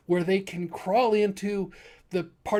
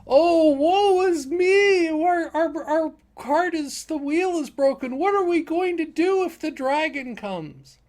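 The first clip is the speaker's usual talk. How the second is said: hammed-up distress; 9 semitones higher, 5 dB louder than usual. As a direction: overplay the helpless victims, high and loud. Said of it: "they're hamming it up".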